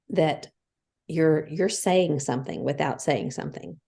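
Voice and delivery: ordinary talk, nothing marked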